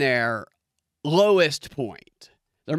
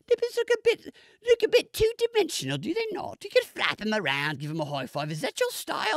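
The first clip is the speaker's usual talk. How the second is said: high-pitched put-on critic voice; mock body critic; 13 semitones higher than usual. Show's host critiquing every inch of a body, high mock voice. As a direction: pick it apart in a squeaky-high mock voice. Acts out critique of naked bodies.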